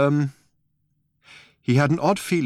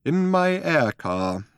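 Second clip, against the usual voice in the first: put-on old man voice; 8 semitones higher, much slower than usual.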